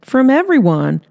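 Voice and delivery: cheerful on-air answer; reporter Hannah. Acted.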